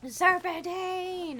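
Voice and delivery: drawn-out, slow mock yell. Quoting the Archer joke to chat, a slow mock yell. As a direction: stretch it like the Archer gag. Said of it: "he just yells it and yells it".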